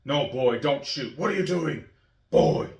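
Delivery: gruff warrior dad scolding his son; imitating Kratos scolding his boy. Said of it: "he just says".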